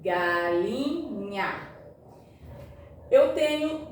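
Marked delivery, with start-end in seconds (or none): 0.0-1.8 Galinha drawn out slowly, syllables pulled apart, lower. Shown as a demonstration. Teacher separating syllables for pupils, slowly. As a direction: stretch Galinha out slowly, sound by sound. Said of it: "Agora, olha aqui".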